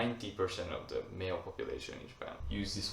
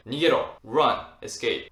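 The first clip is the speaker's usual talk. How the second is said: yelled Nigero, much louder; urgent shout; 3 semitones higher, 10 dB louder than usual. Acted. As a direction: shout the order to flee a tsunami. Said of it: "Person would say".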